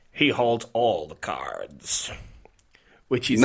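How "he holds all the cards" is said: low, slow, drawn-out impersonation; slow, deep accented movie-character quote; attempt at Deep Throat. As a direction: drop the voice and stretch every word in character. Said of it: "in the accent".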